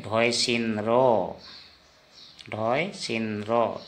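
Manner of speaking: ढोय सिन रो spoken slowly and carefully; slow model. Shown as a demonstration. Teacher drills learners on ढोय सिन रो slowly. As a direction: take ढोय सिन रो slowly, each syllable. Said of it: "क्या उच्चारण करेंगे असमिया में?".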